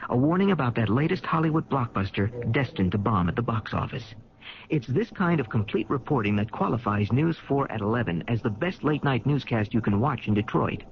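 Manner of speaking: ordinary talk, nothing marked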